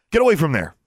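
loud, high sharp command; loud alarmed snap; snapped. A narrator's reading. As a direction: snap at her to get back. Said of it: "Alexandra snapped".

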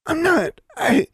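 very high, frightened whimper. A narrator's reading. Pinned girl denying she is a dark sorceress, whimpering. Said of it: "Angelique whimpered".

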